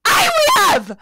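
high, loud screaming at a machine; enraged high-pitched outburst; furious shout. Acted. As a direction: yell it at the top of your voice. Screams floor at the lift.

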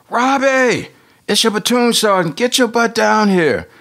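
high, loud, drawn-out call; gruff fatherly shout. Retold or imitated. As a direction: bellow for his son across the house. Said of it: "The guy on the phone's like".

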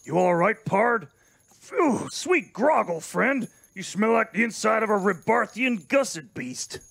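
lightly voiced drawling cowboy; gruff frontier captain, awkwardly sentimental. Bodega toasting the crew, in a lightly voiced cowboy manner.

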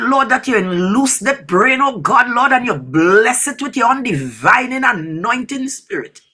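usual speaking voice

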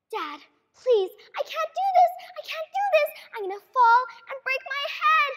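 put-on high, begging child's voice; pleading little girl. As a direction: beg Dad like a scared eight-year-old. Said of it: "pleading with dad as child".

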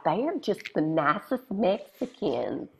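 high, sweet, condescending neighbor voice; mock-sweet Texas neighbor; gushing neighbor. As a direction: coo it like a nosy neighbor.

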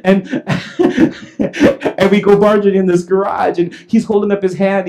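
normal talking voice

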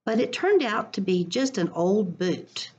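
expressive picture-book read-aloud; reading to children. Acted. Teacher reading a picture book to her class, lively storytime voice.

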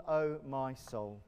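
usual speaking voice

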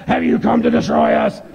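harsh demonic growl; monstrous possessed voice, well below a screech. Acted. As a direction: growl it like an evil spirit. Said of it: "here's how some of them sound".

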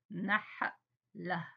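نحلة drawn out slowly; very slow stretched word. Shown as a demonstration. Teacher to young pupils, stretching نحلة slowly. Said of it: "teacher stretches the word".

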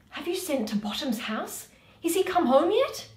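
a lightly voiced anxious Quince, rising at the end. Quince asking the players about Bottom, lightly voiced and worried. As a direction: ask after Bottom with a hint of worry.

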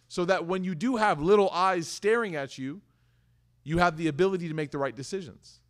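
usual speaking voice

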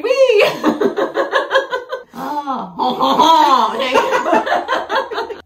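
high, loud laughing fit. Real, not acted. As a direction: crack up laughing. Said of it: "laughing fit".

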